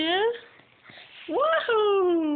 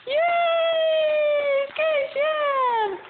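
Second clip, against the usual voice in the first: Yay pitched high, then a lilting good job; excited praise; 9 semitones higher, much slower than usual. Real, not acted. Praising toddler's throw.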